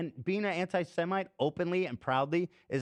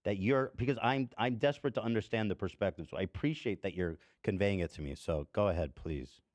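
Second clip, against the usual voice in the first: calming down; 6 semitones lower than usual. Real, not acted. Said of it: "I'm fired up".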